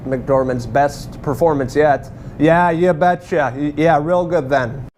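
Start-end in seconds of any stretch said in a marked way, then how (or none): 2.4-4.9 mock Minnesota accent. Reviewer playfully mimicking the Fargo Minnesota accent. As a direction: play it like a cheerful small-town Midwesterner, drawn out.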